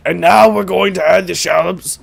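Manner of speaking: anime warrior as cooking-show host; Vegeta. Acted. Vegeta hosting a cooking show, mock-serious impression. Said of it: "doing a cooking show".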